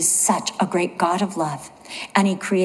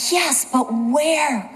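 drawn-out, higher-pitched mock exasperation; exasperated wife; 5 semitones higher, much slower than usual. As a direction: stretch it out slowly, imitating a puzzled wife. Exasperated wife questions forgetful husband's claim.

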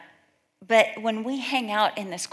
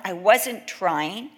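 normal talking voice

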